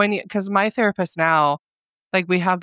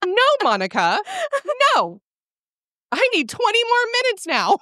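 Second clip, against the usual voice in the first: whiny plea. Retold or imitated. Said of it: "I was like".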